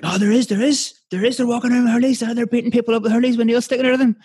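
imitating him in a high, fast-rising pitch; retold insistent fella. Arguing back about the north side of Cork, high-pitched insistent mimicry. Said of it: "then he was like".

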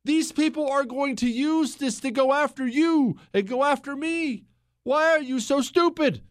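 high, exasperated yelled plea; retold screaming. Host retelling his screamed warning to the right, high exasperated yell. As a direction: re-enact yelling at your own side, pitched high. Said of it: "I was screaming".

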